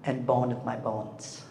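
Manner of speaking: slow, low and soft; hushed, unhurried tenderness; tender declaration. Acted. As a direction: quote it like an intimate vow. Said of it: "We have to talk like that".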